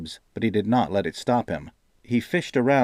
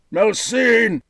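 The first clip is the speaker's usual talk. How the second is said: much higher, louder roar of anguish; 13 semitones higher, 7 dB louder than usual. A narrator's reading. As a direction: howl the name in pain. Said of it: "he roared in anguish".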